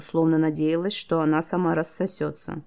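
usual speaking voice

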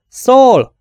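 a loud, high call of the name; shout. A narrator's reading. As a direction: call him from the doorway. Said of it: "kiáltott valaki". (hangos, magas kiáltás)

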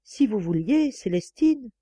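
usual speaking voice

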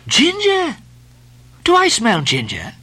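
Stubb sniffing, high and wary; narrowed-eyed sniff of a question; suspicious. Acted. Stubb to the steward over the cup, suspicious. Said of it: "suspiciously asked Stubb".

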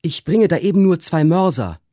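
high, eager servant voice; servant Trim explaining. A narrator's reading. Servant brings two mortars in, explaining in a high voice. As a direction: explain yourself respectfully, pitched high.

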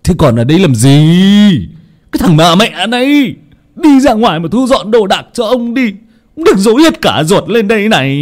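high, loud furious shouting; furious yelling. Acted. Father yells at servant after kicking him, furious high shouting. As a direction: drive the useless boy out.

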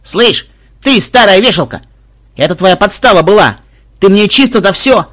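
loud, high-pitched outraged accusation; highly indignant. A narrator's reading. Robber bursting in to accuse the madam, indignant. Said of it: "крайне возмущённый персонаж".

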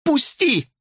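high piercing shriek; panicked squeal. A narrator's reading. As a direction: squeal to be let go. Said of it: "взвизгнула она".

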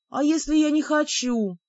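high, plaintive whine; plaintive little girl. A narrator's reading. Heroine resists the role pushed on her, plaintive and childlike. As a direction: plead like a reluctant little girl. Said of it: "Я жалобно хмыкнула, разом уподобившись маленькой девочке".

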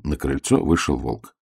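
ordinary delivery

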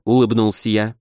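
ordinary delivery, nothing marked

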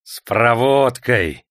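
loud, slow, stretched cries; shouted urging. A narrator's reading. Prokofy cheers on the executioner's lash, shouting. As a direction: egg the flogger on, drawing it out. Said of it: "закричал Прокофий".

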